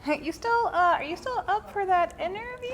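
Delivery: a pretend jittery fan on the phone; mock nervous caller. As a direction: squeak it out like a starstruck fan.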